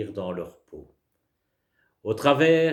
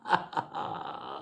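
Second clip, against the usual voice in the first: demo laugh; 10 semitones lower, 7 dB quieter than usual. Shown as a demonstration. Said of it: "et vous riez ensemble".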